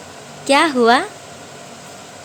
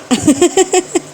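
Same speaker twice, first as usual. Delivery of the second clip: laugh; 5 semitones higher than usual. Real, not acted. Mother laughing at playful baby.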